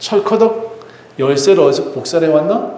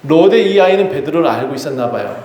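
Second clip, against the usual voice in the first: ordinary talk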